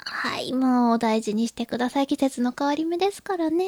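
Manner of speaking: soft whisper. Real, not acted. Sympathetic soft reply.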